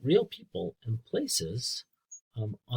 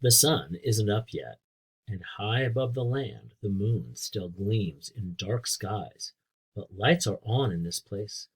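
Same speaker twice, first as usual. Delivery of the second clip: clear, louder storybook reading; reading to children; 5 dB louder, slower than usual. A narrator's reading. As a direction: read it out for a class of kids. Teacher reads book to children.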